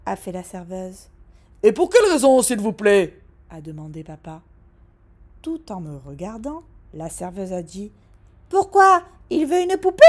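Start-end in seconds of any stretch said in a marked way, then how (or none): 1.6-3.2 loud, higher, pointedly polite; a father's sharp polite question; stiff demand. Acted. Dad demanding reason. 8.5-10.0 high, loud surprised question; waitress voice, much higher and louder; puzzled waitress. Acted. Waitress answering.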